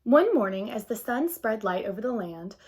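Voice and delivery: put-on gentle read-aloud to children; storybook narration. A narrator's reading. Teacher reading a picture book to the class, gentle narration.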